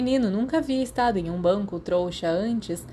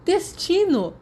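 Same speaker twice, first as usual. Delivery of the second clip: even higher, loud delighted cry; excited exclamation; 11 semitones higher, 4 dB louder, slower than usual. A narrator's reading. James gleefully repeating his news about Lily, high and loud. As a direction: crow the word in triumph.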